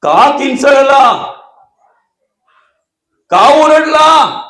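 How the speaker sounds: loud, high demand of why he screamed, the last line drawn out slow; Ravana shouting. Acted. Ravana shouting through the door at the screaming man inside.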